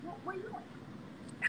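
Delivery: hushed whisper into the phone. Real, not acted. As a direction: whisper back so no one hears. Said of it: "You whispering".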